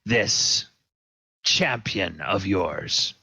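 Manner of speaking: "champion" said with a slow, contemptuous sneer; mock the word "champion", drawing it out slowly. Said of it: "gives the word champion kind of a, sneer".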